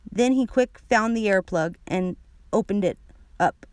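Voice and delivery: usual speaking voice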